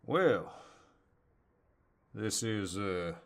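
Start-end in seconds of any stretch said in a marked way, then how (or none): 0.0-0.5 surprised. Acted. Sees her stuck in dryer.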